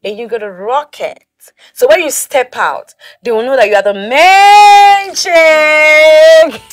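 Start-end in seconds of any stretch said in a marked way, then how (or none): 1.7-6.6 hype shout. Acted. Hypes friend, shouting.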